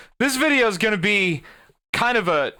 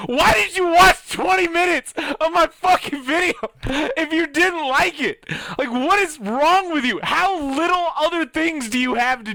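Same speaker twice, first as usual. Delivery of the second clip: higher and louder, yelling in disbelief; angry shout; 7 semitones higher, 4 dB louder than usual. Real, not acted. Ranting at a hater who watched twenty minutes, furious shout. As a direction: blow up at the commenter.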